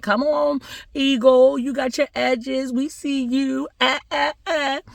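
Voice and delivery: high-pitched cheering; excited onlookers. Acted. Crowd hyping up the eagle's new wig, excited. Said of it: "everybody was all excited".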